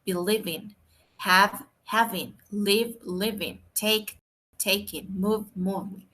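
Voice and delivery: each verb and its -ing form slow and distinct; slow word pairs. Shown as a demonstration. Teacher listing examples for the class, slow and distinct. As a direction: set each pair apart slowly. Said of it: "Por ejemplo, vea".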